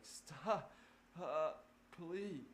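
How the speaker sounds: mock high pleading for the car; hammed-up e-brake begging. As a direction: beg like the brake is suffering. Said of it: "the e-brake's, like, crying out".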